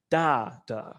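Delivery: stressed first "da", weaker second "da"; trochaic stress. Shown as a demonstration. Lecturer showing students a trochee, first "da" stressed. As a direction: lean on the first "da", let the second "da" fall away. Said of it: "the first syllable is stressed".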